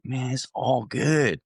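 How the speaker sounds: slow, laid-back reassurance; chill and easy. Retold or imitated. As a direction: let it roll out slowly, totally unbothered. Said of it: "Just chill".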